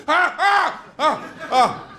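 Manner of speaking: high panicked yelps waking up; mock nightmare screams. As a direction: jolt awake yelping in terror.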